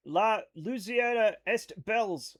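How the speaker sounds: slow French in an American accent; own American accent. Shown as a demonstration. Streamer reading chat's French, stubborn American accent. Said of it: "read things in my accent".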